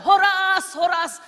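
a big cheerful shout of Horas; loud greeting. Retold or imitated. Preacher greeting the Batak congregation with a loud Horas. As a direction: call "Horas" out loud and high.

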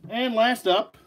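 ordinary talk, nothing marked

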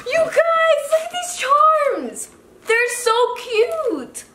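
very high, drawn-out squealing delight; gasp, then gush slowly at a high pitch; thrilled squeal. Real, not acted. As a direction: squeal to viewers over something adorable. Thrilled by charms found in box.

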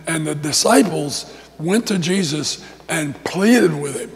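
usual speaking voice

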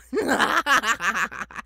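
loud high mocking cackle; evil cackle. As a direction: cackle with menace. Villain laughs at intruder.

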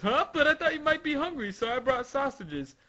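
puzzled servant. Acted. Servant offering Sugar sausages, hurried puzzled voice. Servant line redone after attribution.

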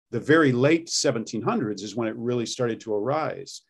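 ordinary talk

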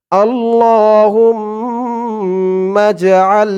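আল্লাহুম্মা drawn out very slowly with long hold; slow recitation. Shown as a demonstration. Teacher modelling pronunciation and elongation, slow recitation. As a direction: intone আল্লাহুম্মা with a long stretch. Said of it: "আগে আমরা আরবিতে শিখি".

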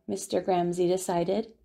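plain reading voice

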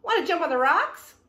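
high, loud storybook goat; goat's invitation. A narrator's reading. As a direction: ask brightly as the goat. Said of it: "said the goat".

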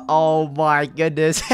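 normal talking voice

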